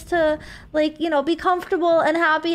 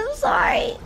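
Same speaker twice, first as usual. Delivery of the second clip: ordinary delivery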